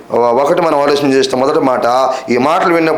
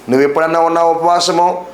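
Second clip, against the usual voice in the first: ordinary talk, nothing marked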